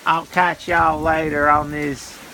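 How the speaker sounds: ordinary talk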